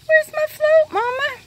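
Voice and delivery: high-pitched pleading little pet voice; voicing the pet. Owner speaking as her pet asking Mama, high pet voice. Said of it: "She says".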